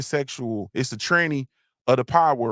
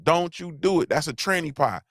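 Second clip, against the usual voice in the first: ordinary talk, nothing marked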